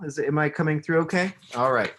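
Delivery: plain reading voice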